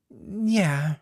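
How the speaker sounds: usual speaking voice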